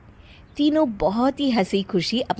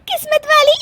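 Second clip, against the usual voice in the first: very high, incredulous giggled question; laughing. Acted. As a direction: repeat it and burst into cackles. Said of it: "हंस क्यों रही हो". (ठहाका लगाकर हँसते हुए)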